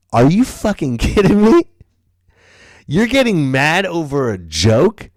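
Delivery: high, loud incredulous shout, laughing; incredulous retort. Retold or imitated. Retried comeback to the storming Marine, high incredulous shout. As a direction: yell it back in disbelief. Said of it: "So then I went".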